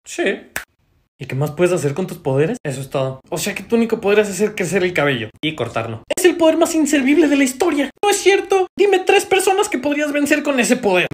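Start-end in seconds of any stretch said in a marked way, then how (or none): 6.2-11.1 loud, high-pitched comic rant; exasperated outburst. Acted. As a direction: burst out scornfully at full volume. Acted outburst mocking useless power.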